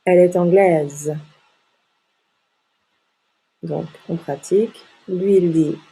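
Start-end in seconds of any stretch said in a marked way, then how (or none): 0.0-1.2 anglaise with a long z ending; sounded final S. Shown as a demonstration. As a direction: finish anglaise on a clearly voiced z. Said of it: "On prononce le S".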